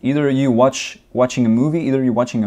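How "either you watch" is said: normal talking voice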